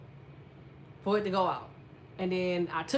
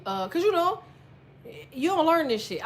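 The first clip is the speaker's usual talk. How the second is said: higher and louder, fed up; exasperated; 7 semitones higher, 5 dB louder than usual. Real, not acted. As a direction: throw up your hands at it. Exasperated aside.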